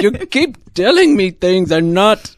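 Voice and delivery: high, loud character voice; mock-outraged mouse. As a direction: squawk back in hammed-up protest. Mouse argues with robot in game.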